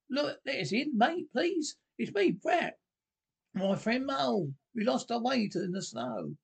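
high, urgent animal pleading at the door; pleading Rat. A narrator's reading. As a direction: beg to be let in, pitched well up.

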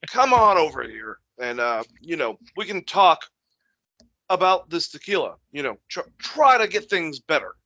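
high-pitched, coaxing mock celebrity voice; hammed-up, halting friendly invitation; the Rock. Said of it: "every time he has to talk to, like, Vin Diesel".

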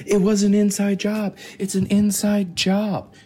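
high, emphatic conspiracy voice; mock a breathless conspiracy podcast host; conspiracy podcaster. Mimicking other podcasts' conspiracy talk.

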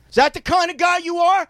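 high, pushing jeer; mock taunting shout. Retelling how he goaded the angry player. Retold provocation, pitch jump.

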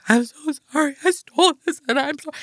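put-on high, pleading little girl apology; tearful kid. Girl confessing to the cashier, high pleading kid voice. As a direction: plead like a guilty child. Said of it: "And I was like".

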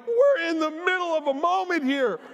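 high, loud, comic protest; mock indignant. Preacher replays rebuffing flight attendant during prayer, mock indignant. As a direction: fend off an interruption in an exaggerated high voice.

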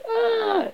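high wailing scream; acted shriek, very high. A narrator's reading.

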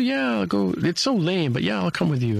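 high, mock-reluctant yes; too-cool agreement. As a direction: pretend it's lame but agree. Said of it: "she goes".

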